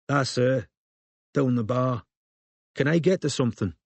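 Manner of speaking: curt unsmiling barmaid. A narrator's reading. Barmaid to Robin, unsmiling and curt. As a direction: give it without warmth.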